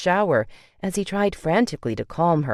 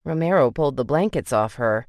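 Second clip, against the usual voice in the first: ordinary delivery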